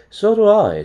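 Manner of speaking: high, loud protest; exasperated retort. A narrator's reading. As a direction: throw it back at him. Harold retorts again, louder.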